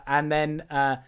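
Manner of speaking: ordinary delivery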